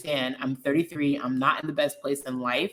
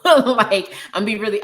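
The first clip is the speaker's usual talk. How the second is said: laughing at herself; real laugh; 6 semitones higher, 8 dB louder than usual. Real, not acted. Admitting she's not strong, laughing loud and high. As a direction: laugh bursts out, higher and louder. Laughs at her own admission.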